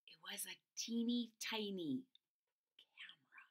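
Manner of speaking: hushed secret reveal; whisper. Acted. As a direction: breathe the word softly.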